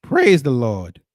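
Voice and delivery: slow, loud churchy praise; mock church praise. Streamer echoing the church lady, slow and loud. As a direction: draw it out like a deacon.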